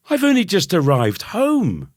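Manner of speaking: high, loud whiny protest. A narrator's reading. Boy protesting to mum in a high whine. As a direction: complain it's unfair.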